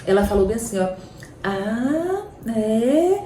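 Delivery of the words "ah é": put-on high baby babble rising; toddler babble. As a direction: babble like a small child. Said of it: "Naquela linguazinha infantil". (balbucio de criança pequena)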